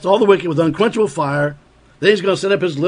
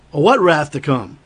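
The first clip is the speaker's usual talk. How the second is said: normal talking voice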